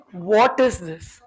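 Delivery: usual speaking voice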